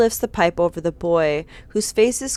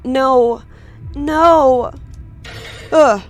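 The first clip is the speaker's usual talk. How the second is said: loud, pained protest; desperate no; 7 semitones higher, 6 dB louder, much slower than usual. Acted. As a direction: cry out against it. Screams during the attack.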